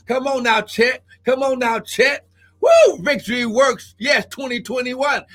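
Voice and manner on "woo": piercing high, loud whoop. Real, not acted. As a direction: shoot up into a joyous whoop. Praise eruption.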